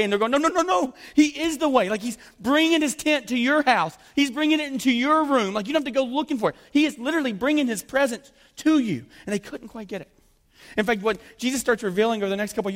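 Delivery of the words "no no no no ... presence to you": excited insistence. Acted. Disciples voiced, correcting eagerly.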